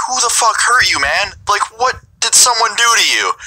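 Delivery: high-pitched, baffled questioning; incredulous. Retold or imitated. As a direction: ask it in appalled disbelief. Imagined incredulous question to threatener.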